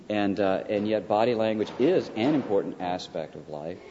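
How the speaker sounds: ordinary talk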